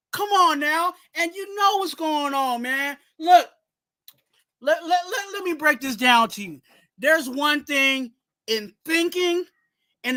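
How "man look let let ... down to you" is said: ordinary talk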